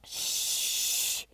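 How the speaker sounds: breathy shush. A narrator's reading. Children hushing Kiko during the story, soft shush. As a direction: hush everyone down. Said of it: "the other children said".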